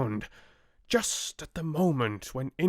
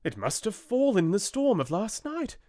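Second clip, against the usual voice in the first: excited; 10 semitones higher, faster than usual. A narrator's reading. Sailors continue, high and fast.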